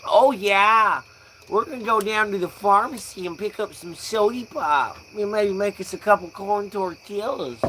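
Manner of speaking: mock a chirpy Midwesterner; Midwestern folk accent. Stoner mocking Midwestern folk, hammed-up Midwestern accent. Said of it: "talking about the Midwestern folk".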